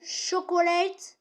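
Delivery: slow teaching word; English word. Shown as a demonstration. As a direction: draw out Chocolate slowly for the class. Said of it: "ça veut dire chocolat".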